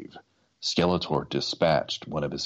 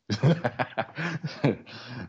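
embarrassed laugh; 8 semitones higher than usual. Real, not acted. Narrator stumbles, laughs.

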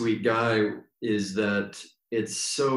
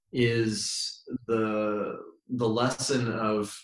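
ordinary delivery

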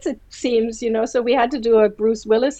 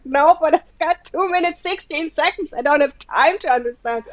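lightly voiced, higher, rushed impatience; impatient interviewer; 7 semitones higher than usual. Interviewer telling the rambling star to just answer, mildly impatient. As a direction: hurry him along, half exasperated. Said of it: "And I was like".

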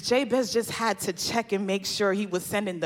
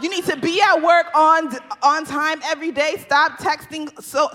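louder rapid-fire rules; preachy mock commands; 6 semitones higher, 7 dB louder than usual. Preacher mocking the rules people set before praying, louder. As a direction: list them like nagging orders.

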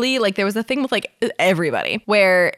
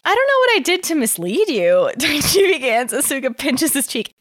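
story narration; 5 semitones higher than usual. Acted. Reads fanfic aloud.

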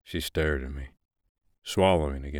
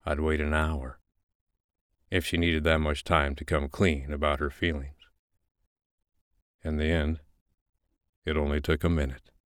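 ordinary delivery, nothing marked

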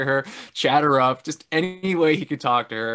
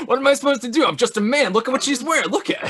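high, loud mock pleading; flustered protest; 12 semitones higher, 5 dB louder, faster than usual. Husband excusing his staring to his wife, hammed-up high protest. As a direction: plead your case in an over-the-top squeal.

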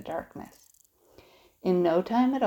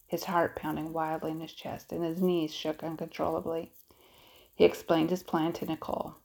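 ordinary delivery, nothing marked

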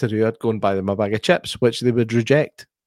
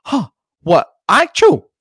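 high, loud mocking teen; mimicking son; 10 semitones higher, 7 dB louder, slower than usual. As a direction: take off the son's baffled reply. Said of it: "he went".

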